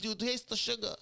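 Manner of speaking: high-pitched joking slogan; high comic voice. Acted. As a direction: send it up high for the bit. Riffing in a put-on voice.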